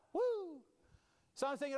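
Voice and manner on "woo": sudden high whoop of joy. Acted. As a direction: let out a cheer like a kid winning. Pastor cheering a marble game.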